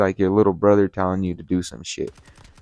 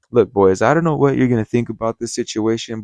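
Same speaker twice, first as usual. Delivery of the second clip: ordinary talk, nothing marked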